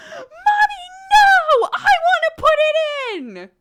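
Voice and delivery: put-on high, loud screaming child; toddler yell. Mother retelling her toddler yelling from across the kitchen, high shrieking child voice.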